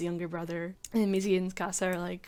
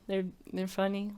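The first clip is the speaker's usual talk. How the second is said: usual speaking voice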